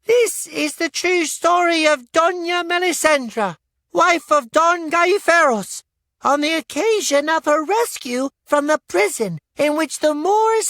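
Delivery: put-on a boy presenting a puppet show; young boy narrator. A narrator's reading. Boy introducing the puppet show to the inn crowd, high and loud. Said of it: "the boy said".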